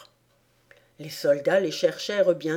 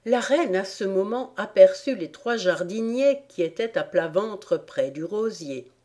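ordinary delivery

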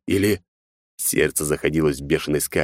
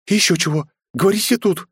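high indignant retort; 13 semitones higher, 3 dB louder than usual. A narrator's reading. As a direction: refuse to be sent away. Mother refuses to leave. (возмущённо)

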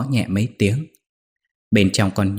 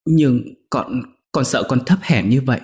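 tearful asking; 5 semitones higher than usual. Acted. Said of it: "chợt tỉnh khóc rồi nói".

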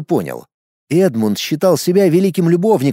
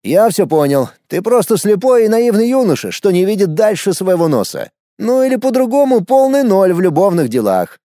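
higher-voiced friend delivering a verdict; pitched-up, animated scolding; exasperated Edmund; 7 semitones higher than usual. A narrator's reading. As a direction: lecture him as a hopeless case.

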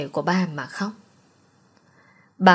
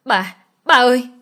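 higher, louder desperate cry; raised, high-pitched shout to a parent; screaming out; 5 semitones higher, 5 dB louder than usual. A narrator's reading. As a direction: call out in a scream. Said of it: "Tôi hét lên".

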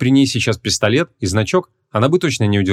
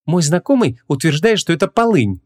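higher-pitched, teasing woman; mocking; 8 semitones higher than usual. A narrator's reading. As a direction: translate it with light mockery. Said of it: "насмешливо перевела Ванесса".

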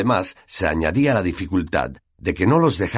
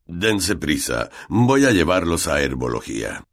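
Snape calling out; 3 semitones lower than usual. A narrator's reading. Snape hurrying the class to herbology, restrained call. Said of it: "les gritó Snape".

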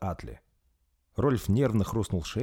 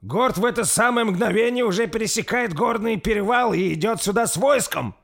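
much higher, louder desperate shout; yelled; 8 dB louder than usual. A narrator's reading. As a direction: yell it while being dragged away. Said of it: "прокричал сир Берси". (Берси кричит, пока его утаскивают)

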